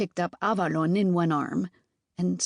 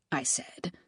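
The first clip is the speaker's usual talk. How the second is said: usual speaking voice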